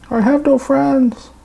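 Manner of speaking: high, slow whiny plea; mock whine. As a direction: play a lonely kid, hammed-up. Joking plea to viewers.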